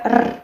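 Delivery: R as a firm tongue-tip trill. Shown as a demonstration. Teacher shows learners the sound, firm R. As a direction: roll the R on its own. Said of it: "this firm sound R".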